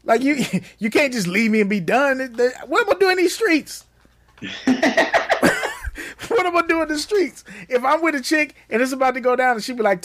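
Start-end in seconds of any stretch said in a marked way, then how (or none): 4.4-6.2 high-pitched breathy laugh. Real, not acted. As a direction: burst into a squeaky laugh. Laughs at own joke.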